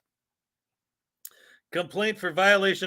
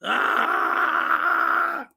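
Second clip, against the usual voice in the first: frustrated yell; 11 semitones higher than usual. Real, not acted. Frustrated at the file format.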